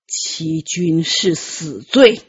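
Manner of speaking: slow, low and menacing, teeth clenched; through gritted teeth. Acted. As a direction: grind each word out slowly as a threat. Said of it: "他咬牙切齿道". (咬牙切齿，低沉缓慢地威胁)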